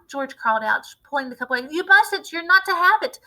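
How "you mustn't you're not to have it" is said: put-on much higher, louder boy's protest; urgent plea. A narrator's reading. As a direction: stop her in panic. George stopping grandma.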